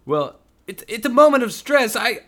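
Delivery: high, loud stammering defence; flustered excuse. Acted. Devin stammering an excuse to Sam. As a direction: babble an excuse, rattled.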